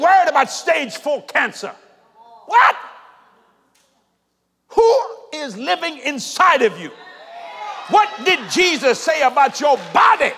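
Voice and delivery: loud, high, shouted challenges to the congregation; impassioned pulpit shouting; fired-up preaching. Real, not acted. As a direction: preach at full force, driving each question home. Preaches at full force to congregation.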